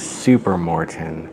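slow, low and soft daydream; murmured to oneself, lost in fantasy; dreamy. A narrator's reading. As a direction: say it dreamily, drawn out and hushed. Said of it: "in a dreamy tone".

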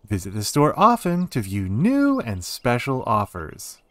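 hammed-up store advert with swooping pitch; punchy, lilting sales-pitch read. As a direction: read it out like a promo announcer.

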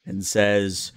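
ordinary talk

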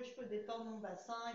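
ordinary delivery

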